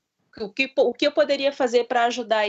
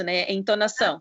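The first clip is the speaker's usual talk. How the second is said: ordinary talk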